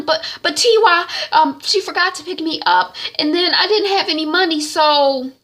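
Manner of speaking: high, loud rushed excuses; flustered excuse. Retold or imitated. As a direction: talk fast to escape blame. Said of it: "Shaliwa is like".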